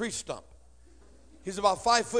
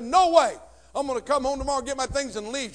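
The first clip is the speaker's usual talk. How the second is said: put-on higher, louder teen outburst; defiant shout; 5 semitones higher, 6 dB louder than usual. Thirteen-year-old refuses his mother, defiant shout. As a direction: throw it back at mom in defiance.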